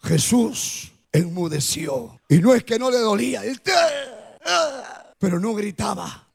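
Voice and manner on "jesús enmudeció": slow, low and hushed, weighing each word; grave slow emphasis; solemn and slow. Shown as a demonstration. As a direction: let "Jesús enmudeció" fall slowly and low. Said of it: "usted grita".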